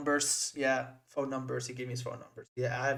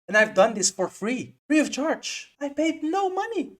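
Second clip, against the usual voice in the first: higher and louder, triumphant brag; gleeful boasting; 11 semitones higher, 6 dB louder than usual. Real, not acted. Vlogger bragging to viewers he paid nothing, excited. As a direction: crow about getting in free. Bragging about free entry.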